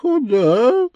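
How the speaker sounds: high, plaintive demon voice; loud, woeful squeak; sad devil. A narrator's reading. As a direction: ask it as a beaten whine. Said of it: "произнёс печальный чёрт".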